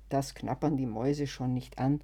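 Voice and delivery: usual speaking voice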